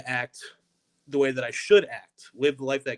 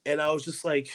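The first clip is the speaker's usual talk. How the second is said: ordinary talk, nothing marked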